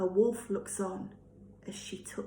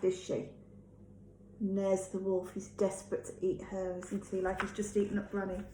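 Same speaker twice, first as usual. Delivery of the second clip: usual speaking voice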